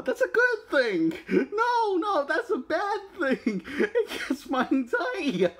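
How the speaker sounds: squeaky high panicky voice, breaking into laughter; mock panic. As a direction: play Bruce freaking out, voice shooting way up. Bruce panicking about another planet.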